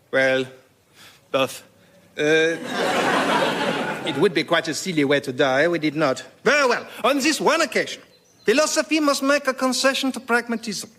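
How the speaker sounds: put-on hesitant French accent turning grand; outrageous French accent. Voltaire backing out of the duel in a French accent. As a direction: back down in pompous French style. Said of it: "in outrageous French accent".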